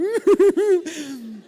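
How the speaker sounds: loud, high comic wail; mock crying. Father retelling how both parents cried in the kitchen, mock sobbing. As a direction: imitate parents bawling, hammed up. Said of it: "ahí estábamos los dos llorando".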